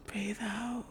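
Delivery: breathy whispered breathe out; whispered exhale. Shown as a demonstration. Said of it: "Breathe in".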